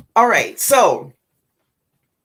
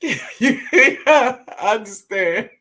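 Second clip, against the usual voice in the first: laughing; 4 semitones higher, 6 dB louder, much slower than usual. Real, not acted. Reacting to viewer's fear.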